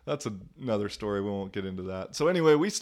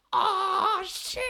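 squeaky high "oh shit" with a laugh, pitched way up; caught out. Retold or imitated. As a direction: yelp as if busted. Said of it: "I'd be like".

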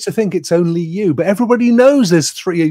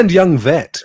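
usual speaking voice

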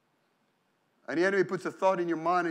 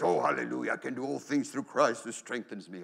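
fake-cheerful hallelujah, lower, with a forced confidence; brave face; 7 semitones lower, 4 dB quieter than usual. Preacher imitating a believer putting on a brave face. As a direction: pretend to be fine while doubting inside. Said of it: "put a brave face".

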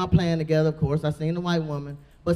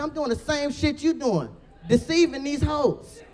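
higher, slow sly confession; 8 semitones higher, slower than usual. Retold or imitated. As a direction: draw it out slowly like a sly confession. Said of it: "I said".

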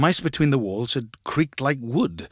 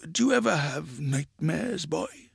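softer, sly probing question; 3 semitones higher, 4 dB quieter than usual. A narrator's reading. Grandpa to grandchild after a pause, slyly. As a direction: ask it slyly, voice held down. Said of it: "he said slyly".

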